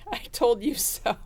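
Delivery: giggling through the words "I told you so"; can't hold back a laugh while saying "I told you so". Retold or imitated. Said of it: "I can't say it without laughing".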